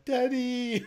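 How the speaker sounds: put-on high, small voice of a little girl; a lightly voiced girl calling her dad; mimicking a grieving kid; child's voice. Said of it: "lying over him going".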